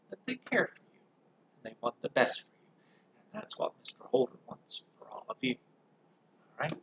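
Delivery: ordinary delivery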